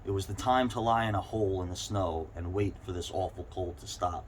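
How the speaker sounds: usual speaking voice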